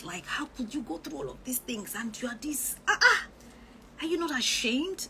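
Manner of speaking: high, loud scornful mimicry of a critic; mocking critics. Mimicking online critics, scornful and shrill-high. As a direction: ham up the sneering critic who asks if she's ashamed.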